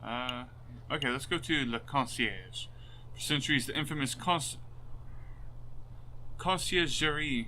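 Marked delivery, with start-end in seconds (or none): 3.7-7.5 sounding out conciergerie carefully; unsure pronunciation. Real, not acted. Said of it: "I'm probably saying that all wrong".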